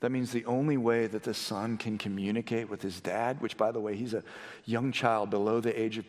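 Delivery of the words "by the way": usual speaking voice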